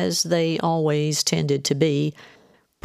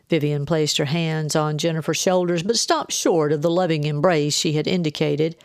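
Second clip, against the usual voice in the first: ordinary delivery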